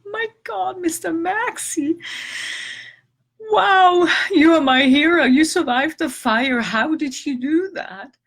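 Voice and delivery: high, loud, gushing praise for a pet; overjoyed cooing. Retold or imitated. To the cat who survived the fire, high joyful cooing. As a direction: gush over the cat like a hero.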